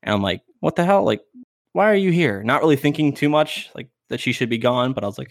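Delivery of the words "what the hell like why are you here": baffled, higher-pitched reliving of surprise; retold bafflement. Teller finds the dog still on the couch, baffled. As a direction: ask it like you can't believe it.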